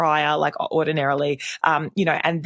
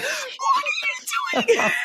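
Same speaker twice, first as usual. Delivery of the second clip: much higher, sharp mock yell; mock angry yell. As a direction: snap at the child like a fed-up mum. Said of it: "ordinarily, I'd be like".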